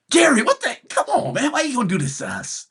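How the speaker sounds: high, loud exasperated outburst; wailing protest, rushing at the end; exasperated pleading. Real, not acted. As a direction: plead in disbelief, pitch way up. Pleading outburst at team owner.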